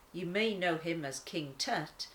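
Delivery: ordinary delivery, nothing marked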